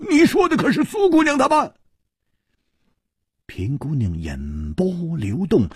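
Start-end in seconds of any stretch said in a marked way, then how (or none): 0.1-1.7 high, rapid, urgent outburst; loud demand. A narrator's reading. Hu Tiehua demands whether she means his friends, loudly. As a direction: burst out with the question. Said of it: "大声地说".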